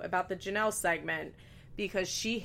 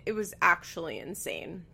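normal talking voice

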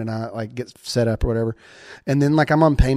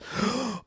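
imitated gasp; 11 semitones higher, 7 dB quieter than usual. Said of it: "like that".

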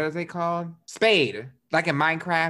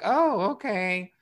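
meek okay; 6 semitones higher, slower than usual. Retold or imitated. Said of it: "she was gonna be like".

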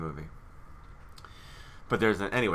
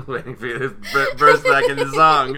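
words through a louder laugh; 4 semitones higher, 12 dB louder than usual. Real, not acted. Host laughs at the cohost who keeps singing, teasing through a laugh. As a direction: tease them while laughing.

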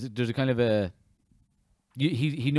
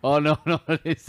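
laughing, alarmed oh no; 5 semitones higher, 9 dB louder than usual. Retold or imitated. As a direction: laugh through the realisation. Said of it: "It's like".

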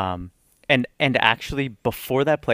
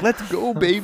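high, teasing hype call; hyping her up while dancing; playful hype; 11 semitones higher than usual. Retold or imitated. To his wife while dancing on her, much higher playful hype.

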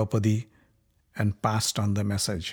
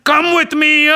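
slow, then higher and louder gloating; cruel taunt; 7 semitones higher, 6 dB louder, slower than usual. Acted. Dussasana taunting Draupadi, slow and gloating. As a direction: savour every cruel word.

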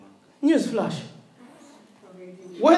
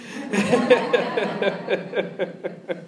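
long rippling laugh; long chuckle; 5 semitones lower, 4 dB quieter than usual. Real, not acted. Preacher who asked the Lord, not congregation, long chuckle. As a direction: keep chuckling at your own quip.

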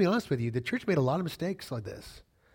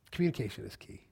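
"Communication is key" said softer and lower; quiet repeat; 5 semitones lower, 9 dB quieter than usual. Shown as a demonstration. Preacher repeating the point to the church, quietly.

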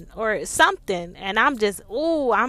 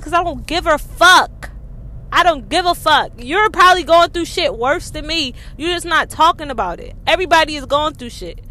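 loud, higher, fired-up rant; defiant; 4 semitones higher, 7 dB louder than usual. Real, not acted. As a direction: preach it with full conviction.

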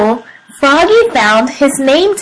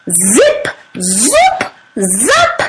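sound-effect words; 3 semitones lower, much slower than usual. A narrator's reading.

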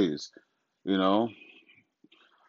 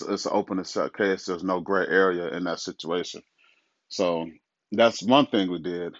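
ordinary delivery

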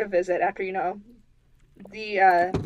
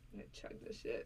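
whispering; 23 dB quieter than usual. Real, not acted. Joking aside about drinking.

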